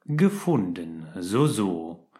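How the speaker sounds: slow, low and sceptical echo; mocking repetition. A narrator's reading. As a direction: drag out the word to mock him. Snape wiederholt Harrys Wort. (Snape wiederholt spöttisch langsam „Gefunden“)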